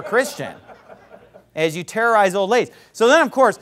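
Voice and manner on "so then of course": usual speaking voice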